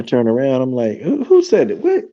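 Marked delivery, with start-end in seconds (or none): 1.0-2.0 high, startled cry of who's there; spooked and high. Retold or imitated. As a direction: jump at an unseen voice. Startled by unseen voice.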